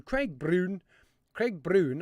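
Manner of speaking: badly faked Scottish accent; terrible Scottish accent. Host greets a viewer in chat, terrible Scottish accent. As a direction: have a poor go at a Scottish accent. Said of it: "that's a terrible Scottish accent".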